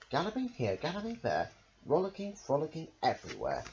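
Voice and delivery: plain reading voice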